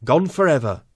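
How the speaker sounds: ordinary talk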